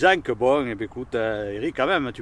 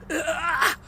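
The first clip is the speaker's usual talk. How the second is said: pained cry. Real, not acted. Just bit into the pepper.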